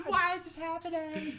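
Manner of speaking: high, slowed hammed-up wail; mock panic. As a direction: drag it out in fake horror. Radio play kid terrified.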